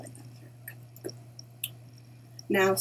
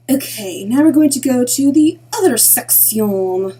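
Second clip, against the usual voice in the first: bad French accent; 4 semitones higher, 10 dB louder than usual. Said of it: "Excuse that very bad French accent".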